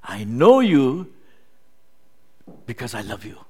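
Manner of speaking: gentle, loving I know you; loving God. Acted. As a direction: say it with love, unhurried. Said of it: "that's what David is trying to say".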